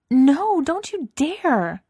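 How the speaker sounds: high, loud, slow mock warning; a squealing, drawn-out don't you dare; playful protest. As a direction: stretch it out daring them not to. Playful video game rivalry taunt.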